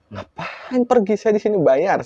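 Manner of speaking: high, drawn-out mock complaint; joking retort. Teller jokes with possessed woman to ease tension, joking retort. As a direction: play the aggrieved paying customer, jokingly. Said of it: "Saya bercanda".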